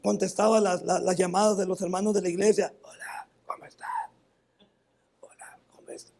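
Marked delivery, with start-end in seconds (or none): 2.8-6.1 breathy toneless whisper, barely audible; voiceless whisper. Shown as a demonstration. Pastor demonstrating his only voice after cancer, whispered. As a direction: show the congregation how little voice was left. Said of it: "Era mi única voz".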